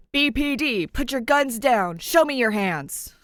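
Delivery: loud, slow commanding yell; police shout. Acted. Police ordering the gunmen to drop guns, loud slow shout. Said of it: "There are more shouts".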